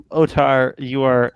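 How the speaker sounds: usual speaking voice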